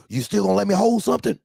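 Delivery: high, fast wheedling plea; caller begging. Retold or imitated. Driver asks the boss for cash, high fast wheedle. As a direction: hurry the ask like a broke hustler.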